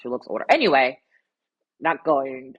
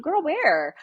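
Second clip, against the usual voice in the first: put-on incredulous girl; 10 semitones higher, 4 dB louder than usual. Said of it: "And I'm like".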